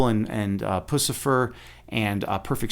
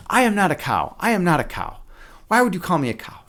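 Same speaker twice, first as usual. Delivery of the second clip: higher, hurt mock protest; mock indignant; 5 semitones higher than usual. Telling Terry what to say, in a mock offended voice. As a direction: play the offended cow, half-joking. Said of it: "You say".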